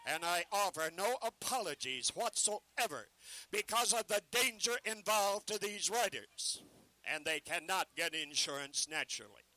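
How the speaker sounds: loud ballyhoo, selling hard to a crowd; carnival barker pitch. Acted. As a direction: bark the show to passers-by.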